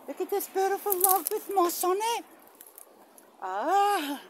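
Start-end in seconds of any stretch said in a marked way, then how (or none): none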